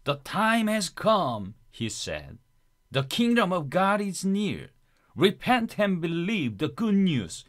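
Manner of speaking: slow, high, declaimed native-speaker imitation; imitating native-speaker narrator. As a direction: mimic an audio Bible reader, drawing out each phrase. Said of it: "그분처럼 한번 따라해볼까요".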